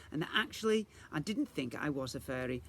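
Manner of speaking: plain reading voice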